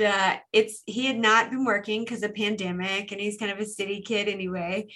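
ordinary talk, nothing marked

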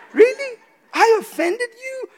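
high, startled "really?"; surprised disbelief. Retold or imitated. Pastor reacting to a churchgoer's complaint, high and startled. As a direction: act truly taken aback she was hurt.

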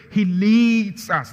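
leads hammered loud, high and slow; emphatic, drawn-out leads; stress on leads. Shown as a demonstration. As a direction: lean hard on the word leads. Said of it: "I'm just trying to emphasize".

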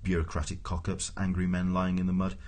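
plain reading voice

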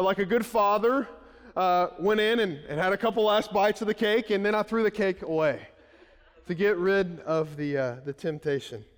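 usual speaking voice

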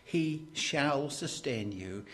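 slow, measured line weighted on SHALL; deliberate emphasis on the word shall; stress on SHALL. Shown as a demonstration. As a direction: lean on shall, unhurried and certain. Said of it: "I'm putting emphasis on that word".

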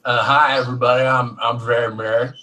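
badly faked American accent, introducing himself; Mary impression. Australian asked for a co-host impression, hammy American accent. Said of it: "Do your best Mary impression".

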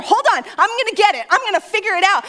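high, loud, obsessed insistence; frantic determination. Acted. Wife insisting to husband she'll find the caller, frantic.

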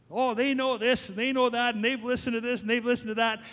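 teasing parody of a know-it-all; mocking know-it-all. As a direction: lightly mimic a smug teenager.

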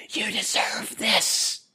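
slow, unvoiced tempting whisper; seductive whisper. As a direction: whisper like sin luring you in. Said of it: "It says".

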